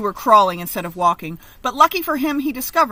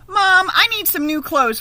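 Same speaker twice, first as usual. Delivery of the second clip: raised, high-pitched plea for new clothes; cried out; 11 semitones higher, 7 dB louder than usual. Acted. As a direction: call out to mom, pitched up and louder. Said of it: "Rigo cried one day".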